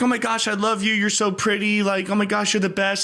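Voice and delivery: put-on high, sugary girlfriend gush; gushing girls. Meme girls fawning over each other, high and gushy. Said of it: "three girls talking to each other".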